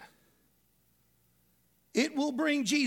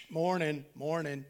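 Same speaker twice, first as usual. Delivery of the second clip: lightly voiced cartoon greeting; 5 semitones lower than usual. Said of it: "Sam the sheepdog".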